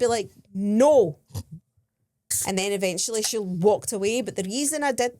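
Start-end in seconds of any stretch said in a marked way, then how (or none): 0.5-1.2 one loud, firm mock no; retold firm refusal. Tourist to aggressive rose seller, retold loud firm refusal. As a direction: shut them down with volume. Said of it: "quite aggressive, and I had to be like".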